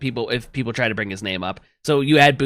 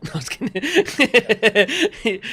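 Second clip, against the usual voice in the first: laughing; 9 semitones higher, much slower than usual. Real, not acted. Player laughs at own joke.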